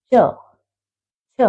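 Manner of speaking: च said alone, tongue pressing the palate; sounding out च. Shown as a demonstration. Teacher has students feel the tongue on the palate, crisp. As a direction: say च twice, crisp and separate. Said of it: "आप बोलकर देखो".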